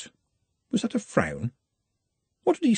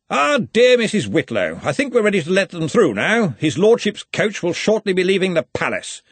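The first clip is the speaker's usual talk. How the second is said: high, hearty, booming Arch-Chancellor; big jovial voice, pitched up; 9 semitones higher, 4 dB louder than usual. A narrator's reading. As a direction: genial authority moving things along.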